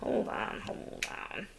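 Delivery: hushed mutter to herself; whispered. Real, not acted. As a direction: whisper it under your breath. Muttering while fiddling with craft.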